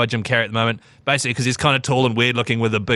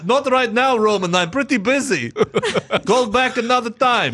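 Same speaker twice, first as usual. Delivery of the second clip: high laughing Eastern European voice; Russian accent; 10 semitones higher than usual. Pretend. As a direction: Nico brushing off his cousin. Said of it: "And then I go".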